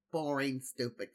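ordinary talk

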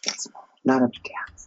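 breathy hushed aside; whisper. Real, not acted. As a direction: say it in a secret whisper. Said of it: "whispered reply".

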